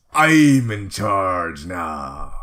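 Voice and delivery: very slow, loud pirate boast; pirate captain voice. Pretend. Quoting the pirate card's line.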